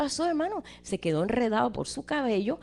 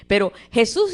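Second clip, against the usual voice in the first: usual speaking voice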